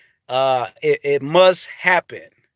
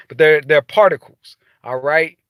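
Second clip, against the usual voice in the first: ordinary talk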